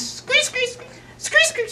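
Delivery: very high squeaky screeches; bat screeches. Acted. Narrator imitating bats screeching in the cave. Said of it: "like that".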